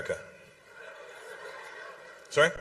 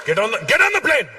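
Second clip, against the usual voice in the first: hammed-up parents shooing him onto the plane; mock parents yelling; 2 semitones higher than usual. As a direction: parents yelling at son to board, mock shout. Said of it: "Your parents went".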